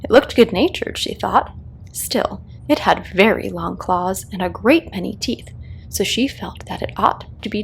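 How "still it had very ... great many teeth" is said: ordinary delivery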